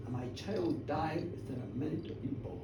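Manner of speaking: low, very soft, sorrowful confession; grieving. A narrator's reading. Janet telling the village of her child's death, hushed grief. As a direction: let the voice sink with the loss.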